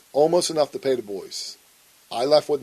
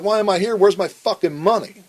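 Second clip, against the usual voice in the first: higher, pushy retold confrontation; retold angry demand; 7 semitones higher, 4 dB louder than usual. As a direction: throw it in his face, demanding the money. Retold nose-to-nose demand for money.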